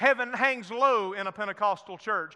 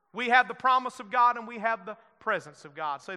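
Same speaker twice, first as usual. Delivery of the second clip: ordinary delivery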